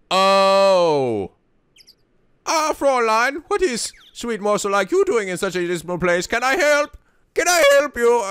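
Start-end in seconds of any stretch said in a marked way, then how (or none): 2.4-8.2 put-on court her in an overdone German accent. Voicing a smooth German charmer to a lady. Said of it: "learn to do a German accent".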